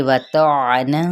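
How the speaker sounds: وطعنا very slow, with a heavy ta and a clear ain; slow tajweed demo. Shown as a demonstration. Teacher models وطعنا for students, heavy ta and clear ain. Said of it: "طا حروف متعالیہ".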